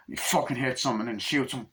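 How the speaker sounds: ordinary delivery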